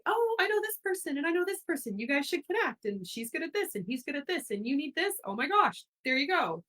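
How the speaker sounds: high, eager, bubbly connector voice; bubbly matchmaker. Retold or imitated. Imitating her younger self playing connector, high and eager. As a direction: gush about introducing friends to each other. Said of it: "I was always like".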